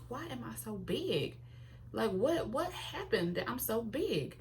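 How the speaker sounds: high, slow bewildered whining; mock dismay. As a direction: play up the shock at her own size. Shocked by her reflection.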